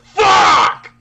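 high, loud shout; yelled at a board game loss; shouted curse. Retold or imitated. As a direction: scream it in frustration. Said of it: "Just like".

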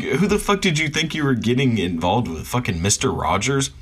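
ordinary talk

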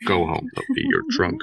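a hint of Idris Elba. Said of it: "hear Idris Elba now".

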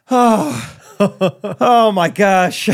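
laughing gasp of disbelief, drawn out slowly; overwhelmed sigh. Retold or imitated. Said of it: "I would just, like, sigh".

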